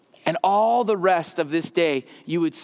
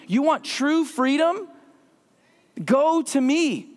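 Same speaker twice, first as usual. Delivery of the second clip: God's invitation; 5 semitones higher, slower than usual. Acted. Repeats God's call, slow.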